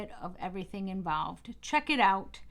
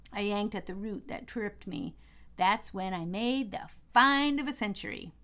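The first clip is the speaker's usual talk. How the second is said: plain reading voice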